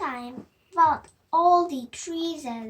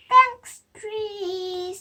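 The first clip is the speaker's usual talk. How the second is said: delighted thanks; 5 semitones higher, 7 dB louder than usual. Acted. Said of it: "said Sam".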